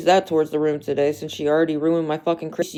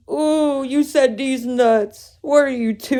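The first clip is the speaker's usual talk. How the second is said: mock teasing; 7 semitones higher, slower than usual. Real, not acted. Mocking a childish joke.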